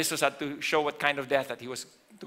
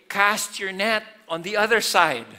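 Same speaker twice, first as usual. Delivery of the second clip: higher, louder call imitating a shout; retold shout; 6 semitones higher, 7 dB louder than usual. Preacher quotes Jesus calling to fishing disciples, mild shout. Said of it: "And Jesus shouts".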